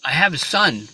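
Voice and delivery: high, loud lament of a father; aggrieved old rabbi. Acted. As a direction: pour out a hammed-up complaint to heaven. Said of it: "The rabbi says".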